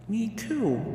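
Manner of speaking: soft, high hushed whisper. A narrator's reading. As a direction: breathe it quietly. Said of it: "Fluttershy whispered".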